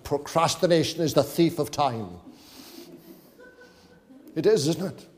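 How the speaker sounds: light Welsh lilt on the proverb; mild Welsh-accented proverb. Shown as a demonstration. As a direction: say it like a Welshman, gently. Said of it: "this is what it sounds like in a Welsh accent".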